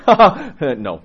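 loud, high laughing no; laughing refusal. Retold or imitated. Priest refusing a catechumen who wants to read The Rudder, laughing. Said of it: "I'm gonna say".

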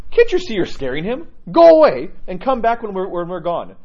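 elder scolding a snake. Retold or imitated. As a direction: tell the snake to go away, for the frightened monk's sake. Elder tells snake to leave.

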